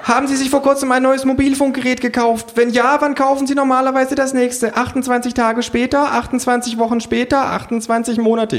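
very high survey patter; relentless student interviewer, high pitch; screeching pollster. Acted. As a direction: rattle off a questionnaire in a high put-on voice. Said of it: "Von ihrem Geschrei angelockt".

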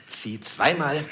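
usual speaking voice

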